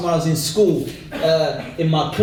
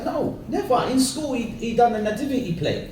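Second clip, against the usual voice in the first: higher-pitched mum's voice; flustered mum; 4 semitones higher, 4 dB quieter than usual. Acted. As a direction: answer high and modest, as his mother. Voicing his mum answering casting woman.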